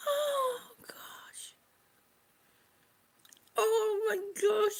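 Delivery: high, breathy whispered gasp; whispery, awestruck; overcome. Real, not acted. Overcome watching baby scan video.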